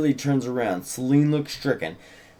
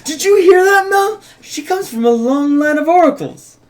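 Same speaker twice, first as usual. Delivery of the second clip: much higher and louder, thrilled; excited gushing; 13 semitones higher, 7 dB louder than usual. Acted. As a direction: squeal it like a delighted fan. Urania gushes over the oracle news.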